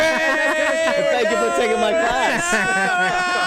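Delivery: high, slow, hammed-up thanks; mock grateful. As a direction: overdo the gratitude. Mock grand thanks, laughing.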